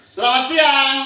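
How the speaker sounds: high, loud cue with a laugh in it; called greeting with a laugh. Shown as a demonstration. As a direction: call it out, laughing. (salam keras sambil tertawa)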